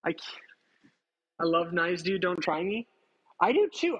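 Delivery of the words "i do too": excited. Real, not acted. Excited reply to chat.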